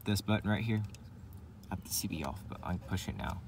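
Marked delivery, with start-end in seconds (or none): none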